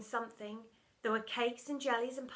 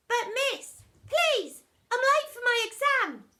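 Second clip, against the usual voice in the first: much higher, loud desperate plea; pleading child; 12 semitones higher, 10 dB louder than usual. Acted. Pupil begging Miss Seeth to let him pass. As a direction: beg like a panicked kid.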